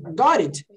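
"Got it" linked, T flapped to R; R sound in "Got it". Shown as a demonstration. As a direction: say the T of "Got it" as a tapped R. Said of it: "Pode falar com som de R mesmo". ("Got it" com T virando R)

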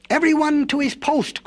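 high-pitched call to places; monkey's cry. A narrator's reading. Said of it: "cried the monkey".

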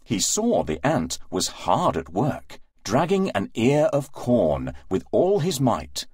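usual speaking voice

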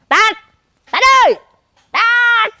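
loud high call across the house; mother yelling for her son. Acted. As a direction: shout the boy's name from afar.

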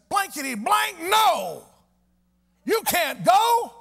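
high, loud, slow outburst forbidding the trip; loud, drawn-out doctor's no; doctor's angry refusal. Retold or imitated. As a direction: bark it out like a furious doctor.

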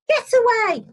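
high, loud shooing of a bird; high-pitched scolding shout. A narrator's reading. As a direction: shout it away like an angry farmer's wife. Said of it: "and shouting".

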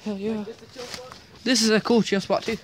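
ordinary talk

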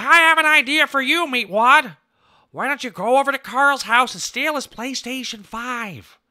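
high, loud cartoon milkshake voice; Master Shake impression. Scheming milkshake ordering Meatwad around, high and loud. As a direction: pitch it way up and push it out loud. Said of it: "Let me try and do Master Shake now".

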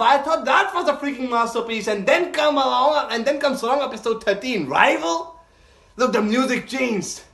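high-pitched, incredulous excitement; astonished raving. Real, not acted. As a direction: rave in amazed disbelief. Amazed the episode topped the last.

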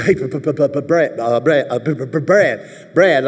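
stammering b-b-Brad, straining; imitating stutter. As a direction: act out a coach stuck on his name. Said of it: "He stuttered".

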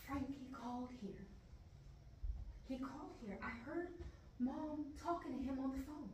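hushed, secretive confiding; whispering. Acted. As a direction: keep it very soft, as if overheard. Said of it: "Why are you whispering?".

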